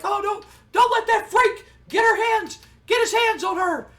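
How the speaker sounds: very high, loud mock shriek; hammed-up frantic squawk; panicked King. Commentator pleading to protect Sable, frantic high cry. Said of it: "And King's like".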